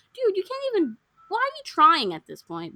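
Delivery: high-pitched mock telling-off; exasperated. Viewer scolding Arjun for gaslighting her, mock exasperated. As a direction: talk back to him in high disbelief. Imagined telling-off of film character.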